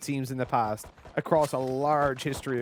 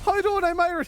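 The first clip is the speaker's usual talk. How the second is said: high, hammed-up Irish accent; mock strong Irish accent; faster than usual. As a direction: lay the Irish on thick. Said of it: "I was like".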